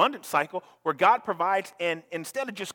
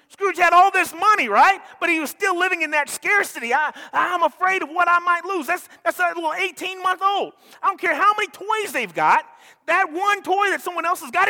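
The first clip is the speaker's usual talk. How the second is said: loud, high, driving sermon delivery; pushing the point home to the congregation; full-force preaching; 12 semitones higher, 4 dB louder than usual. Acted. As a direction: preach it with rising fire.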